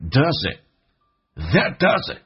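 does it linked as dazit, final t dropped. Shown as a demonstration. Teacher demonstrating linked "does it". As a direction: run "does it" together, drop the T. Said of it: "所以这句话就变成That".

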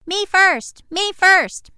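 high, loud eager pleading; eager calling out. Acted. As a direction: shout it like an eager kid.

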